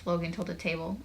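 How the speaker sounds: usual speaking voice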